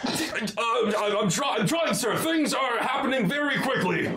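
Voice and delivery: high-pitched, stammering subordinate; flustered sergeant. Acted. Sergeant grabbed by the collar, high and flustered. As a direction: stammer excuses up high, overwhelmed.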